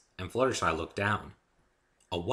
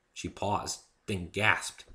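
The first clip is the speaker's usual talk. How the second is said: plain reading voice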